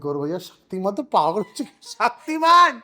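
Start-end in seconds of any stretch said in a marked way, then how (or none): 1.9-2.8 loud, high call of the name; excited shout. Real, not acted. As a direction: yell it out like a fan. Jokingly yells the name.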